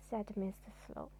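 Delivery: ordinary delivery, nothing marked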